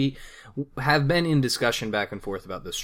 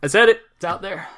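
mock dramatic confession; 11 semitones higher, 8 dB louder than usual. Mock confession about platinum.

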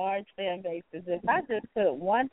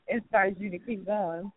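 ordinary delivery

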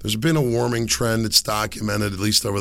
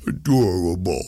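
exaggerated, self-mocking ADORABLE; mock gushing. Shown as a demonstration. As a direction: say ADORABLE in a cutesy put-on voice. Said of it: "I hate to even use adorable".

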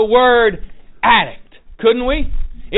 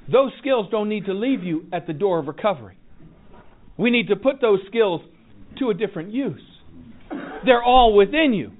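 usual speaking voice

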